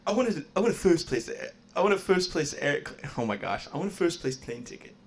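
Stewie impression. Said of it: "I can kinda do Stewie".

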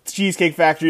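ordinary talk

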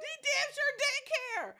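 high, loud, stretched out through laughter; laughing agreement. Real, not acted. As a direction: agree emphatically while laughing. Joins the laughing retort.

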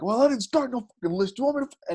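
half-acted hothead squaring up; mock angry outburst. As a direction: act out the guy about to fight. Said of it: "he gets crazy".

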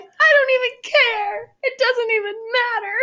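high, drawn-out mock crying whine; imitated child's tantrum. Parent mimicking daughter refusing goldfish consolation, mock wailing. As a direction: bawl it like an inconsolable kid.

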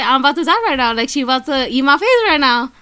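normal talking voice

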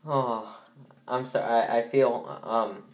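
ordinary delivery

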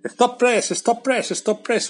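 breaking news call. Acted. Host opening a news segment, mock urgent announcer. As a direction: announce stop press like a newsroom jingle.

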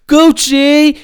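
loud, high-pitched shout of the name; excited holler. Real, not acted. Host hyping the rapper [name], excited holler. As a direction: holler it like a hype fan.

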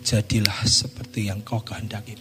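low, slow, stately pronouncement; solemn Jesus. Acted. Jesus answers the woman's faith, low and solemn. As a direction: grant it slowly in a deep voice.

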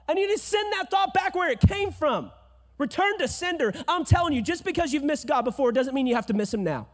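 loud, high fiery sermon; full-force preaching. Real, not acted. Pastor rousing the congregation, fiery and loud.

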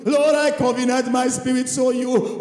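sobbing devotion to God; tearful prayer. Acted. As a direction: cry it out on your knees. Voicing crying believer praying on knees.

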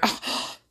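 mock affronted gasp; huffy gasp. Bumped shoppers react, huffy gasp. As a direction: suck in air, playing offended. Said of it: "they look very huffy puffy".

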